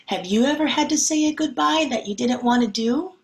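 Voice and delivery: ordinary delivery, nothing marked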